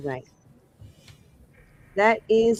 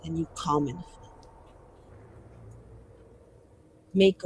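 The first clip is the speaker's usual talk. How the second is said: soft, measured mage speech; restrained defiance; 3 semitones lower, 8 dB quieter, faster than usual. Acted. Mage telling off someone over who decides their fate, quietly defiant. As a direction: keep the defiance low and contained. Player repeats mage's bitter speech.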